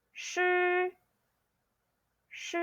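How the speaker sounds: "shi" curled-tongue, high and level; pronunciation model. Shown as a demonstration. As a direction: hold "shi" like a high, even "shr". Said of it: "you say".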